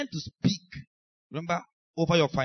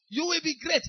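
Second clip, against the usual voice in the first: prophet declaring; 11 semitones higher than usual. Retold or imitated. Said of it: "Elijah says".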